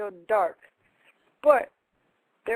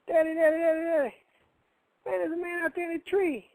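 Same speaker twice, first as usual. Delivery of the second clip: put-on high, urgent boy calling his father; mock-frantic child's plea; panicked child; 8 semitones higher than usual. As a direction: rattle it out like a frightened kid. Boys run to wake dad.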